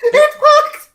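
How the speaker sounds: high, loud, laughing disbelief; real laughter breaking through the words; laughing exclamation. Real, not acted. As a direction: crack up as you exclaim. Laughs as the taunt succeeds.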